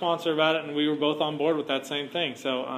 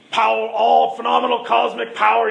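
higher and louder grand genie voice; 7 semitones higher, 9 dB louder than usual. Retold or imitated. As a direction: boom it out like a cosmic genie. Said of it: "and he goes".

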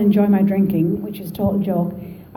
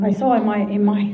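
normal talking voice

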